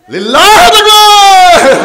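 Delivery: high, long-drawn rallying cry; full-voiced call for a response; slogan shout. Real, not acted. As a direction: shout the takbir to rouse a crowd. Calls the crowd to the takbir.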